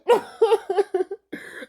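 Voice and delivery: high-pitched giggle; high laugh. Real, not acted. Laughing at the Nickelodeon scene, high giggle.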